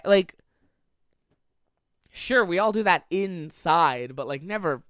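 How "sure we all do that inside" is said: ordinary talk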